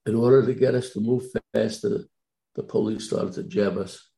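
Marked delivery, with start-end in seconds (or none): none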